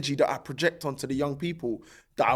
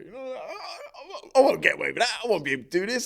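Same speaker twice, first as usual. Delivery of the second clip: laughing; 10 semitones higher, 3 dB louder than usual. Real, not acted. Laughing while recalling self-doubt.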